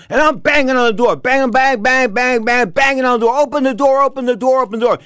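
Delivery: high, insistent retold hollering; urgent door-banging demand. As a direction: hammer out the words like fists on a door.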